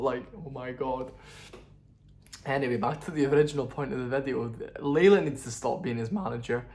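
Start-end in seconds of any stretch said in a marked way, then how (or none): none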